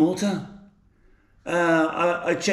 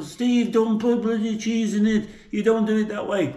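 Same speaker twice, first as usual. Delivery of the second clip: imitated Newcastle accent; 5 semitones higher than usual. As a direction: mimic a Geordie viewer telling Steve off. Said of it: "probably you from Newcastle".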